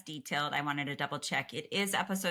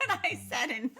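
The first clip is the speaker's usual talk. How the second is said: real laughter breaking into the words; higher, talk through your own laugh; 9 semitones higher than usual. Real, not acted. Co-host amused at modeling the last word, laughing. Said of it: "I'm laughing".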